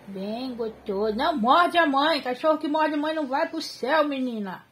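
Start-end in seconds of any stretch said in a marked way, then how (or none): 1.2-4.7 bronca alta e aguda, rápida no fim; bronca brincalhona. Real, not acted. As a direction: ralha com o cachorro, meio rindo. Bronca no cachorro que morde. (bronca alta e rápida no cachorro)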